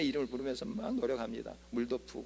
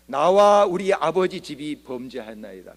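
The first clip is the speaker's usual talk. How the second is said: slow, louder, earnest confession; 10 dB louder than usual. Acted. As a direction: pray it slowly and heavily, with full weight. Nehemiah's prayer quoted.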